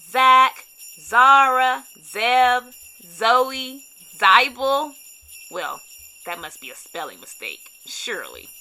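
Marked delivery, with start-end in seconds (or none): 5.5-8.5 much lower, soft wry aside; dry aside. A narrator's reading. Narrator comments on the odd name, low dry aside. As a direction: drop the voice for a wry joke.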